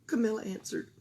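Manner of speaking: plain reading voice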